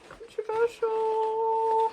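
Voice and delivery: high, slow, drawn-out sing-song; playful controversial. Real, not acted. Mum admitting she distrusts teachers, teasing sing-song. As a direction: stretch it out teasingly.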